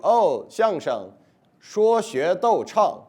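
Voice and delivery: imitating a Chinese person reciting a slogan proudly; Chinese native speaker. Explaining xiangsheng to an audience, mock native Mandarin speaker. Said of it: "they're gonna tell you".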